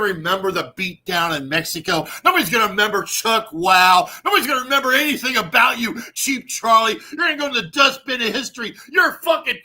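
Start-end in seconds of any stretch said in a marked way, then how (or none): 2.2-9.6 rising into a loud, high mocking yell; gloating yell at a rival streamer; taunting shout. Real, not acted. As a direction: taunt him louder and louder, ending in a shout.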